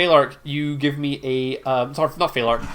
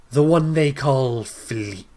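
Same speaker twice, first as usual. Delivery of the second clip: a lightly voiced, imperious goddess; slow and proud, rising toward the question; storm queen; 6 dB louder than usual. Storm queen confronts Philippe, imperious.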